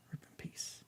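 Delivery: a whispered mock-solemn aside, unvoiced. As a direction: breathe it like a joke eulogy.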